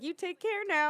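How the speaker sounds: mock fatherly send-off; imitated older gentleman. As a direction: imitate his concerned goodbye, pitched high. Older man's parting words, acted out.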